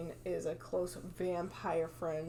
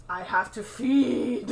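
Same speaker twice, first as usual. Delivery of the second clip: mock hungry vampire; 3 semitones higher, 6 dB louder than usual. Vampire with red eyes.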